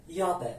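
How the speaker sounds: یابه opening on a y glide; double l as y. Shown as a demonstration. («یابه» با صدای ی)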